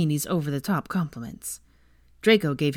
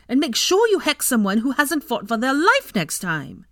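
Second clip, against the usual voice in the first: high, loud, furious scolding; angry screaming; 8 semitones higher, 6 dB louder than usual. A narrator's reading. Ginny berates the boy who hexed her friend, screaming in anger. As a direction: tear into them at a raised, outraged pitch. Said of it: "Ginny was screaming in anger".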